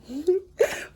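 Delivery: short giggle rising high; light laugh. Real, not acted. Reader laughing at her own Leo impression, giggling. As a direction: laugh at yourself after the bit.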